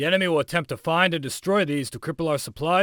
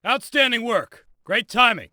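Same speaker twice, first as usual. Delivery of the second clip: raised, higher-pitched congratulation, drawn out; emphatic praise; 7 semitones higher, slower than usual. Acted. Instructor praising trainee's timing after the drill, emphatic.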